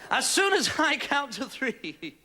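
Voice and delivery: slow, drawn-out instruction; slow, portentous countdown warning; hypnotist's command. Acted. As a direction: stretch each word like a stage hypnotist. Hypnotist instructs his subject.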